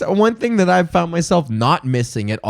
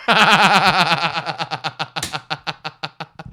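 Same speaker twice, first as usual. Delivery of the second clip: long laugh. Real, not acted. Laughing at his own joke.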